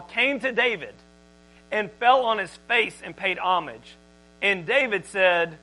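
usual speaking voice

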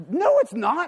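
high, loud mock-angry outburst; retold furious protest. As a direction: act out an indignant, heated denial. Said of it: "now I'm at DEFCON five".

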